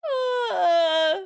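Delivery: long, loud, high groan; loud cry of mortification; anguished wail. Real, not acted. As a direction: let out a drawn-out wail of embarrassment. Wails after embarrassing story.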